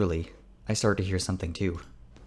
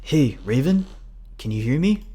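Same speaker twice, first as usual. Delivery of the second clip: louder, higher rising questions; raised voice, checking on him; calling out; 7 semitones higher, 6 dB louder than usual. A narrator's reading. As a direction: call to someone who is not responding. Carl calls to dazed Raven.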